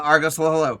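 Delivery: loud, high call across the room; calling the dog. Real, not acted. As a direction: call the dog over brightly.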